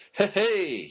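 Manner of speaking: loud, high "hey, hey" like an annoying guy; hammed-up party-guy hello; mock catchphrase greeting. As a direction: barge in with a cheesy greeting. Mocking a catchphrase guy entering.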